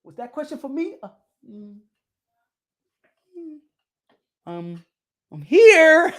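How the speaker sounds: hesitant mumbled mock of John Ryder; imitating John Ryder. Vlogger mimics John Ryder awkward at press conference, lightly voiced. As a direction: play him awkward and unsure, then blurting. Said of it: "John Ryder was just sitting there like this".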